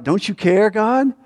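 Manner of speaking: acted anguished appeal, pitched well up; pleading disciples. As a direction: cry out in distress to someone who should care. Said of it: "Mark says it this way".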